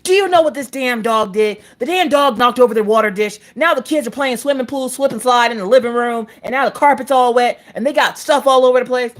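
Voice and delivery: high-pitched exasperated tirade; indignant acted-out venting; agitated rant. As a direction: rattle off grievances in a worked-up high voice. Acting out her angry rant.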